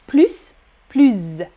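"plus" as pluss, then "plus" as pluzz; S then Z endings. Shown as a demonstration. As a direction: hiss the first "plus", buzz the second. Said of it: "beaucoup de prononciation".

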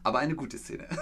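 ordinary talk

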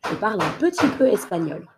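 quick everyday speed; fast natural take. Shown as a demonstration. Teacher giving the sentence at natural speed. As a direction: say it fast like a native.

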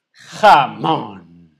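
booming, drawn-out reveal; grand announcement. Pretend. Unveiling ham brought from Spain, grand booming announcement. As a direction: announce it loud like a star act.

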